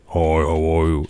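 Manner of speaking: lightly voiced low, slow imitation; a hint of a slow, low self-parody; impression of me. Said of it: "Most people's impressions of me are just".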